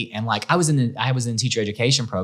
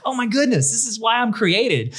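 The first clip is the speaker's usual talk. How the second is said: excited discovery; 10 semitones higher than usual. Retold or imitated. Retold moment of joy.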